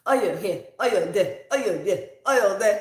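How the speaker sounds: mock Nigerian father, each phrase hit hard; dad's Nigerian accent. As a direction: list it off in a heavy Nigerian accent. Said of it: "in his Nigerian accent".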